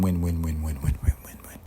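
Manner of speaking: a chant that trails off into a breathless whisper; fading to whisper. Acted. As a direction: let the repeated word die away to a whisper.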